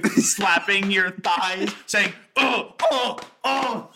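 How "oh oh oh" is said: mock moans, pitched up; hammed-up suggestive moaning oh's; sexual moaning. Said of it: "It sounded so fucking sexual".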